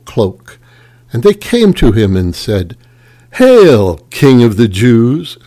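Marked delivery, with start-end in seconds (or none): 3.3-5.4 mocking hail. A narrator's reading. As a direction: hail him with scorn, voice raised. Soldiers mock Jesus.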